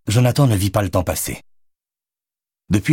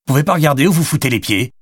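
fast, higher, louder yell; angry shout; 8 semitones higher, 4 dB louder, faster than usual. A narrator's reading. Jonathan yelling at the woman who knocked him over, fast and loud. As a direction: shout it at her, rushing the words. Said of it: "cria-t-il".

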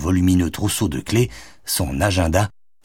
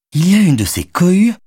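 dismayed lament; 12 semitones higher, 6 dB louder than usual. A narrator's reading. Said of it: "se désola Madeleine".

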